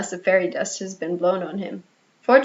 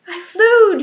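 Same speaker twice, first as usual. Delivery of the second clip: much higher and louder joyful scream; 11 semitones higher, 8 dB louder than usual. A narrator's reading. Little Michael screaming with joy in midair, loud. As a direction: shriek with the thrill of flying. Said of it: "he screamed".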